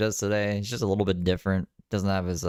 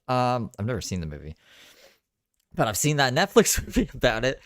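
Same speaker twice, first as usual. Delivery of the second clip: ordinary talk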